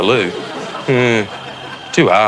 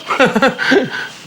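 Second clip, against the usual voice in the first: high laugh; 14 semitones higher than usual. Real, not acted. Dad laughing at cruelty.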